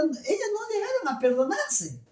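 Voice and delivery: ordinary talk, nothing marked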